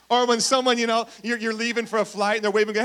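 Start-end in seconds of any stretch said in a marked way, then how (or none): none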